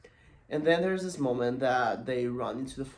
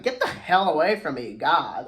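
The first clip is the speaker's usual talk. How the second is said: loud, higher-pitched acted outburst; exasperated rebuff; 4 semitones higher, 11 dB louder than usual. Girl tells the kid following her off, loudly exasperated. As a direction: push him off with loud annoyance.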